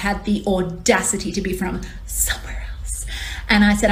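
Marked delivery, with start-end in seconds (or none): 2.1-3.1 whispered as if it were shameful; hushed, sarcastic aside. Retold or imitated. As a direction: drop into a mock-scandalized whisper. Mocking the question about her origins.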